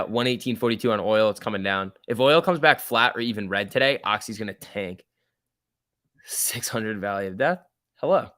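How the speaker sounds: ordinary talk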